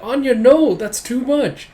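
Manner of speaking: high, loud protest as a dismayed schoolgirl; loud, scandalized no; shocked objection. Acted. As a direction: scold a friend, pitched high. [name] scolds Anya in retelling.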